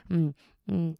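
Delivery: plain reading voice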